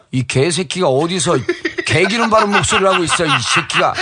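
retold tirade, half laughing; mock angry cursing. Politician cursing the reporter on the phone, mock angry.

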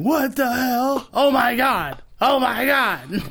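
high, drawn-out mock disbelief; imitated dying laughing. Comic imitating friend on the phone, dying laughing. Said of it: "Eddie's dying laughing, going".

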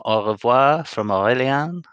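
mock classy French accent. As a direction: put on a posh French accent. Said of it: "doing my proper French accent".

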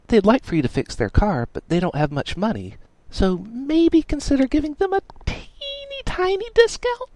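high, sweet, pleading; sweet girl voice. Acted. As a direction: ask sweetly for a favour. Miranda's voice, read aloud.

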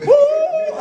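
high, loud whoop of delight; excited whoop. Retold or imitated. Preacher cheering his dad's answer, high loud whoop. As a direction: cheer like you just won. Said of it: "I'm like".

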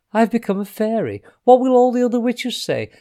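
high, rising panic; hammed-up witchy dismay; dismayed outburst. A narrator's reading. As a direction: fret aloud in alarm. Witch dismayed at becoming a fairy.

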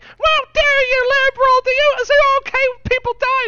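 very high, loud mock screech; Ben Shapiro screaming. Guest storming out of interview, hammed-up screaming. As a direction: scream it in exaggerated high-pitched outrage. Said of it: "And he runs out of the room screaming".

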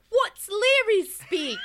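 put-on high, loud, drawn-out kid question; hammed-up wide-eyed child asking; mock children. Children asking the lamplighter about his slang, mock children's voice. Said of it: "the children are like".

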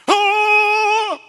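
high laughing shriek; laughing squeal. Acted. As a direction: yelp it in glee. Mock squeal after skit.

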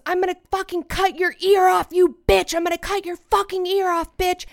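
high, monotone mock threat; imitated threatening yell. Guest imitating the man running at him, high threatening yell. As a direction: rant it high, like an unhinged attacker. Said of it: "And he goes".

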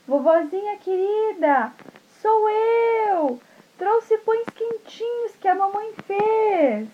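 put-on high, slow little-girl voice; sweet little girl. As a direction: sing out sweetly at the door.

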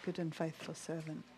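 soft, low words of approval; gentle commendation. Retold or imitated. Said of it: "And he said".

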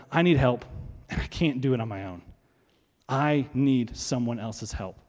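low, subdued confession; humble admission. Acted. Voicing people admitting helplessness.

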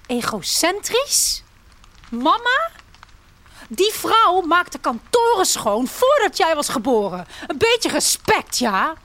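high, sharp and offended; indignant outburst. A narrator's reading. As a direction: flare up in disbelief at the insult. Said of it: "Mijn zus stuift op".

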